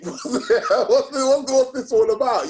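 laughing bafflement. Retold or imitated. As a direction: chuckle in disbelief while asking. Retelling confused first listen to tapes.

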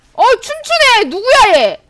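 high, loud astonished outburst; startled laughing disbelief; amused surprise. Real, not acted. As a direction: react to a dancing enemy. Enemy dancing mid-fight.

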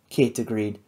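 ordinary delivery

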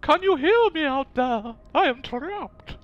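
high, slow theatrical damsel plea; hammy stage actor. Retold or imitated. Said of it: "Wonderful theatrical voice there from Miss Liara".

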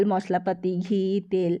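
ordinary delivery

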